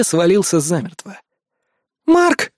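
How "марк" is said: high-pitched delighted call; overjoyed exclamation. A narrator's reading. Азуми to her rescuer, overjoyed. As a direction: cry out his name with joy. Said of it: "обрадованно воскликнула Азуми".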